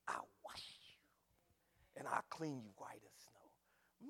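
slow, low whisper; hushed, drawn-out assurance; whispered promise. Acted. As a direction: breathe it out softly, drawing words long. God's tender promise, acted.

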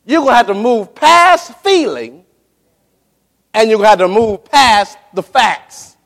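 ordinary delivery

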